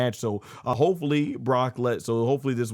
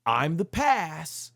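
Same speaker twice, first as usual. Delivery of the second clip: slower, weightier retake of the line; quoting Brock Lesnar; 6 semitones higher, much slower than usual. Retold or imitated. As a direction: draw out past like a final verdict. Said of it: "he goes like".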